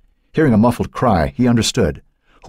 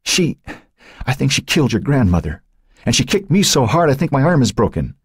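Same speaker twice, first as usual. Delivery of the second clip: tearful, recovering; 9 semitones higher than usual. A narrator's reading. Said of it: "when her sobs subsided enough".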